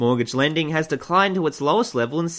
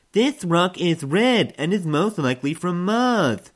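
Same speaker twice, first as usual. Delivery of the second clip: mock student presenter; 4 semitones higher, slower than usual.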